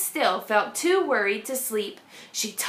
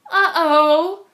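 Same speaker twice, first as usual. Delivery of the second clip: a dismayed little groan, pitched up; 4 semitones higher, 8 dB louder than usual. A narrator's reading. Jessica groaning at her teacher's news. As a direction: let it sink out of you like bad news. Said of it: "groaned Jessica".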